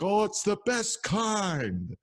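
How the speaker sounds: very high, drawn-out singsong comeback; mock heckler voice, slow and high-pitched; imitated heckler. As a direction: stretch it high like a heckler crowing back.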